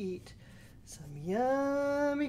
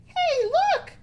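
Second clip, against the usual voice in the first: put-on very high, loud, squeaky kid goat; tiny excited billy goat calling out; little goat voice; 11 dB louder than usual. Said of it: "said the littlest billy goat".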